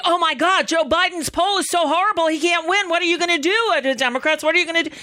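high, fast panicky newscaster mockery; mock the frantic cable news doom; alarmist doom warning. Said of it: "a montage, um, of all of their fear-mongering".

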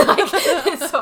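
bursting into high laughter on the word; laughing. Real, not acted. Laughing at the quiz mistake.

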